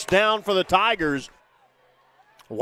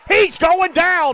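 high, loud, slow sack call; excited yell; 8 semitones higher, 6 dB louder, slower than usual. Real, not acted. Quarterback sacked.

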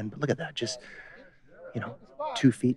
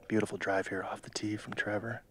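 ordinary talk, nothing marked

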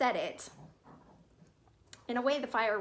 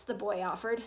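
ordinary delivery, nothing marked

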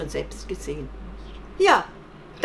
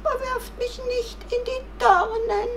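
crying out; 4 dB louder than usual. Acted. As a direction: beg loudly in a squeaky voice. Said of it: "rief das Kaninchen". (hoch rufend und flehend)

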